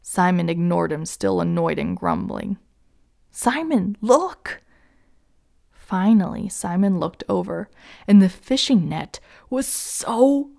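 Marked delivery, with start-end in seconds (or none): 3.3-4.6 high, urgent call for attention; excited call. A narrator's reading. Andrew calls his brother to the net, excited and urgent. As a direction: call out higher, with mild volume.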